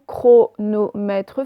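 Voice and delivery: "chronomètre" slowly split, cro-no-mè-tre; slow dictation of "chronomètre", tre fully voiced; syllable by syllable. Shown as a demonstration. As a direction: sound out each syllable of "chronomètre". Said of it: "Faites bien syllabe par syllabe".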